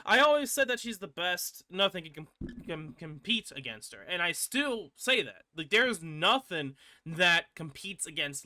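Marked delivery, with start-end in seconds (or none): none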